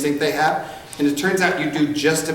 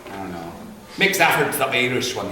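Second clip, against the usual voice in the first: normal talking voice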